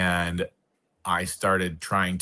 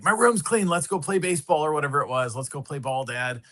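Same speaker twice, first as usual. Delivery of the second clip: put-on high, excited child's voice pleading with dad; eager little son; 8 semitones higher than usual. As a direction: play the kid begging his dad to go play. Retold son begging dad to play.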